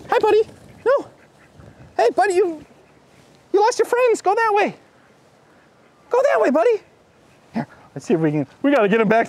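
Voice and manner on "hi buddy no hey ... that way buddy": high sweet coaxing voice. Real, not acted. Host coaxing a lost duck to its friends. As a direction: coax the lost duck back gently.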